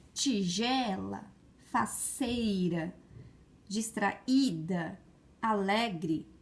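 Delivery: slow "tigela, faceira, distraída, alegre", stretching GE, CEI, Í, LE; drawn-out stressed syllables. Shown as a demonstration. Teacher sounding out words for pupils, "tigela, faceira, distraída, alegre" with stressed syllables stretched.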